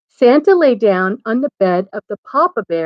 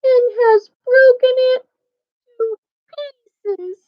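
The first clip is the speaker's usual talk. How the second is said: put-on very high Baby Bear, halting and fading; tiny bear voice, dwindling on the last words; wee little voice. A narrator's reading. Baby Bear finds his chair broken, wee little voice. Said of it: "in his wee little voice".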